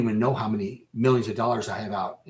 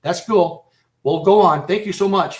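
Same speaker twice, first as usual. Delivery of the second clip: mock cheerful thanks; 6 semitones higher, 6 dB louder than usual. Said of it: "They're not gonna say".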